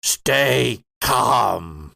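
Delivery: a lightly voiced alien, calm command. Creature tells the player to stay calm.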